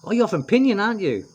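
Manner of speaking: high, loud, cheerful mock policeman; excited policeman quote. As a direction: bounce it up like a delighted cop. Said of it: "one of the guys said".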